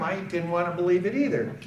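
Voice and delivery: usual speaking voice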